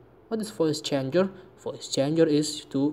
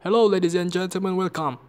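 announcer voice; 3 semitones higher, 4 dB louder, faster than usual. Shown as a demonstration. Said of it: "let's try it again".

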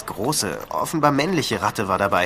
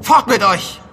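angry shout; 10 semitones higher, 8 dB louder, slower than usual. Acted. Shouting at the rats.

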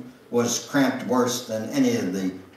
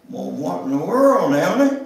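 surprised; 6 semitones higher, 5 dB louder than usual. Acted. Victor surprised at her request.

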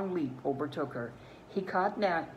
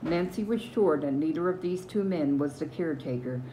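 ordinary delivery, nothing marked

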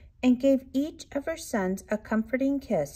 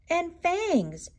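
screeching; 8 semitones higher than usual. A narrator's reading. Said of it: "screeched Chester".

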